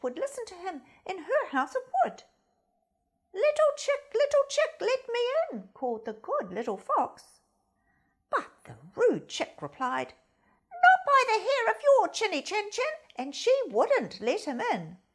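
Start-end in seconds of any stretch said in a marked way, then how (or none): 3.3-5.7 high, loud sing-song call; calling fox. Acted. Fox calling at the wooden house, high sing-song. 10.7-13.0 high, loud, snippy chick voice; rude chick. Acted. Chick refusing the fox entry, high and rude.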